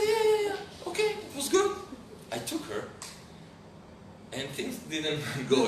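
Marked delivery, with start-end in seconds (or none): 0.1-1.7 put-on very high child voice; little girl agreeing. Little girl agreeing to her father's deal, mock-childish. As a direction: squeak out a child's quick agreement. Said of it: "She said".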